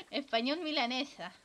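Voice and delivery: repetido entre risas; ríete de tu chiste mientras lo dices; riendo. Real, not acted. Repite su propio chiste riendo. (riéndose de su propio chiste)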